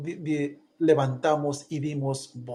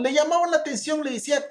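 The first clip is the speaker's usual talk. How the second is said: normal talking voice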